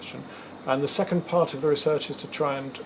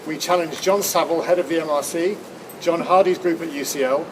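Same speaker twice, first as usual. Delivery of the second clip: loud, raised announcer voice; formal announcement; 5 semitones higher, 6 dB louder than usual. Acted. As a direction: proclaim it to the camera. Announces the Ice Bucket Challenge.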